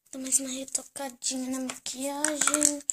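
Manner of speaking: ordinary talk, nothing marked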